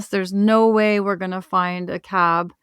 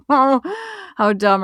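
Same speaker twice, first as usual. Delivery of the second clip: slow words laughed out at their own foolishness; slow laughing self-mockery; 6 semitones higher than usual. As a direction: laugh through it, amused at yourself.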